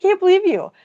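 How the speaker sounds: high, loud mock disbelief; mock outrage. Teasing a viewer who offered her zitty husband, mock outraged. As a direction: pretend to be scandalized, loudly.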